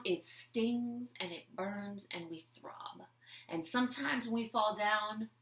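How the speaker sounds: slow hushed whisper. Acted. To listeners about falling, slow whisper. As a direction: breathe it out, no voice.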